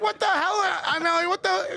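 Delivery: high-pitched indignant outburst; worked up. Retold or imitated. Storyteller protesting to the guards, worked up. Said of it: "you're at a ten".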